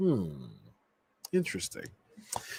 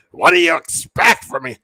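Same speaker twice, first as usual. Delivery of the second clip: higher, louder raspy outburst; old Vince shouting; 6 semitones higher, 9 dB louder than usual. Retold or imitated. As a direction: bark it like an irritated old boss. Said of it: "Vince's voice".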